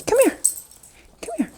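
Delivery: sing-song high call; high dog call. Real, not acted. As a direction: call him up high and soft.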